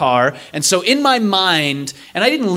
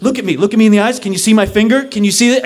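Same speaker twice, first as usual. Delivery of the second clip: insistent doctor; 6 semitones higher, 4 dB louder, faster than usual. Retold or imitated.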